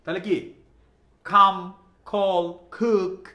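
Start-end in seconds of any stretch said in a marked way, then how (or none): 1.2-3.3 Come, call, cook, each loud with a "kh" opening; aspirated C. Shown as a demonstration. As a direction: puff air after the C in "Come, call, cook". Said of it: "খ উচ্চারণ হয়েছে".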